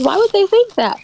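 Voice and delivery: high-pitched incredulous question; baffled disbelief. Retold or imitated. Cohost recalling her reaction to others' disgust, baffled. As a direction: puzzle aloud, pitch jumping up.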